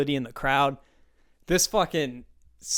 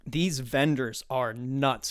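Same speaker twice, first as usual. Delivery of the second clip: ordinary delivery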